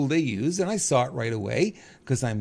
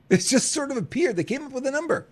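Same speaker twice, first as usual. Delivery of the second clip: repeated higher, leaning on sort; mocking echo; 10 semitones higher than usual. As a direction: echo the line back with a sneer, pitch up.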